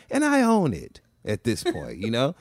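normal talking voice

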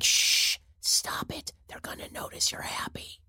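urgent mock stage whisper; Pelosi shushing. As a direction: hush them in a secretive whisper. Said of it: "And Nancy Pelosi's like".

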